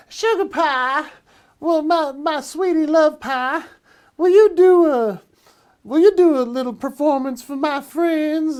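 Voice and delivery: Jane impression. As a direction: sweet-talk him into performing. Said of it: "That's my impression of Jane".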